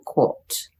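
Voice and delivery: "caught" the British way, with rounded aw; British "caught", lips rounded on the vowel; British accent. Shown as a demonstration. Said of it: "but the British say".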